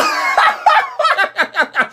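high squealing laughter; shrieking laugh. Real, not acted. Friend's roast sends him into high squealing laughter.

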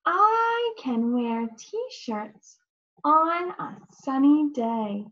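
put-on slow, clear model sentence for a child to repeat. Shown as a demonstration. Teacher models the sentence for a child, slow and clear. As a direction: read each word slowly so it can be copied. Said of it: "Let's practice that sentence".

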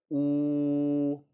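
long, level 우 with protruding lips; lips pushed out. Shown as a demonstration. Teacher coaching learners, rounded sustained 우. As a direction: push the lips further out and hold 우. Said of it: "더 내미세요".

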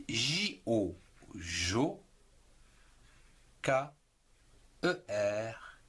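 ordinary talk, nothing marked

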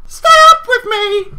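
put-on very high, loud excited kid; hammed-up pleading child; little boy voice. A narrator's reading. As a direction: squeak it like an eager little brother. Voices little brother in story.

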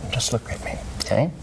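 soft hushed instruction; whispering. Real, not acted. Hypnotist inducing trance.

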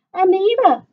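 high, loud joyful call of a name; yell. Acted. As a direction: shout her cousin's name across the room. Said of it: "she yelled".

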